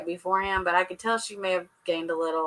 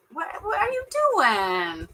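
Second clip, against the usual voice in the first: incredulous; 8 semitones higher, slower than usual. Real, not acted. Mocking the guy's bad kiss.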